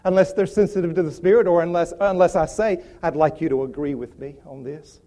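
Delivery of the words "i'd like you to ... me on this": low, softened private ask; calm, low-key and confiding; understated request. Acted. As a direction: quietly ask a friend for prayer support. Acts a private request for prayer.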